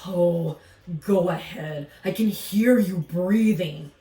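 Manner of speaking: a gruff alligator defending himself; grumpy alligator voice. Acted.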